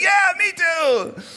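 put-on drawn-out, squeaky little kid chiming in; eager kids. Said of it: "All the kids like".

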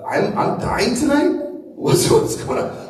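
high, loud, panicked disbelief; a startled teen hearing he could die tonight; alarmed teenager. Retold or imitated. Fifteen-year-old reacting to the preacher, high panicked alarm. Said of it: "And I'm like".

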